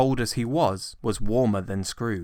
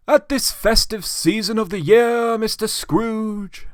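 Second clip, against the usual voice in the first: higher, courteous, lightly ceremonious; polite portly gentleman; 13 semitones higher, 6 dB louder than usual. A narrator's reading. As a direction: address the old man with formal politeness.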